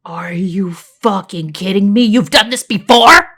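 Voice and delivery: shocked, rising to loud; blurting. Acted. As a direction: blurt it out in disbelief. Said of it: "I blurted".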